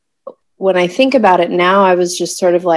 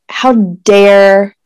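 mock-furious, spelling out each word; retold rage; slower than usual. Imitating her silent fury at the midwife, slow and indignant. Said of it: "just filled with rage".

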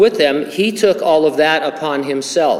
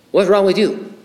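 blunt doctor in a mock Chinese accent, higher; 6 semitones higher than usual. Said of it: "so I'm gonna do a Chinese accent".

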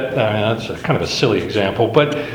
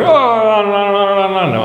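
angry shouting; 7 semitones higher than usual. Retold or imitated. Angry passenger yelling at the baggage clerk, retold shout. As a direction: imitate the furious passenger yelling no. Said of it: "He screamed shouting at the".

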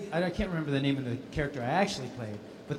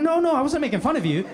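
flustered apology; 12 semitones higher, 6 dB louder than usual. Retold or imitated.

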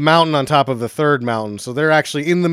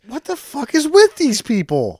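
exasperated; 12 semitones higher than usual. Real, not acted. Exasperated reaction to film.

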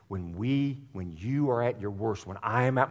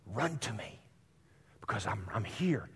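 softer, half-whispered invitation of God; whispered Run to me; 7 dB quieter than usual. Retold or imitated. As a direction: hush it tenderly. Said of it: "he's saying".

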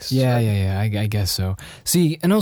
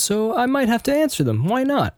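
upbeat; 11 semitones higher than usual. Real, not acted. Cheerfully deciding to take questions.

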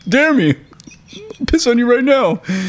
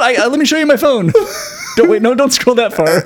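high, flustered and laughing; laughing panic; 10 semitones higher than usual. Retold or imitated. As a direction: plead through laughter, much higher. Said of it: "They're like".

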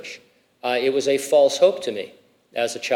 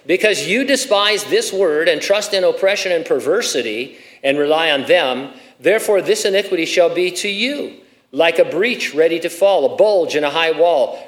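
loud, emphatic Bible reading from the pulpit; scripture reading; 3 semitones higher, 4 dB louder than usual. Acted. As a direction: proclaim the verses with raised pitch and volume. Reads scripture aloud forcefully.

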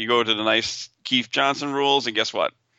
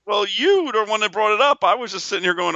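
indignant; 10 semitones higher than usual. Real, not acted. Mock indignant retort.

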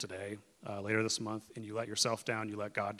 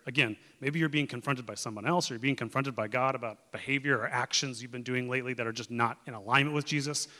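usual speaking voice

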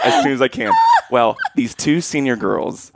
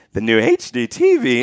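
imitated voice crack. Freshman starts his class presentation, voice cracking. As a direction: voice breaking upward on the last letters. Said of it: "My voice was cracking".

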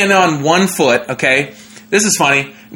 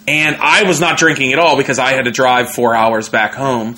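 ordinary talk

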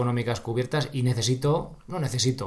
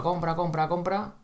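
mock pushy seller; 6 semitones higher than usual. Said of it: "soltar una chapa".

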